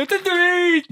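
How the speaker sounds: high, whiny lament of a folk singer; mocking folk singer wail; mock sad folk singer. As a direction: wail it like a parody of a sad song. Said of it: "gente diciendo".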